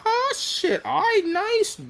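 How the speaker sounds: high, thrilled "oh shit"; mock delighted driver; delighted surprise. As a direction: squeal with pleased surprise. Driver pleased with big tip.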